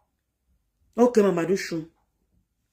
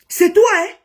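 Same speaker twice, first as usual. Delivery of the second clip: high, loud and firm; raised furious ultimatum; angry warning; 8 semitones higher, 5 dB louder, slower than usual. Real, not acted. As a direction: lay down the law, furious. Furious live rant at rival.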